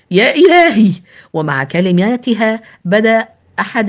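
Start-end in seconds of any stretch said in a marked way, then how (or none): 0.1-0.9 high, loud cry of horror; escalating alarm, almost shouted; horrified cry. A narrator's reading. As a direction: cry out aghast, pitch and volume leaping. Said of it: "في ارتياع مرددة".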